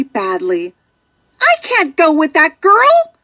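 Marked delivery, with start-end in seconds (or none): 1.4-3.1 high pleading little-fish voice; upset cry. A narrator's reading. Little fish refusing to go to Darla, upset cry. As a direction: beg not to be given away. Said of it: "cries Nemo".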